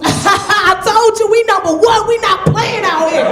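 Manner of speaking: high, loud, drawn-out bragging; proud hometown cheer; excited boasting caller. Retold or imitated. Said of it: "They like".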